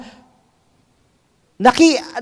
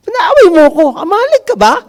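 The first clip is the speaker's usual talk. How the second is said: higher, louder indignant cry; mock provoked victim, pitched up; exasperated outburst; 10 semitones higher, 4 dB louder than usual. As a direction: blurt it out like you've been picked on for nothing.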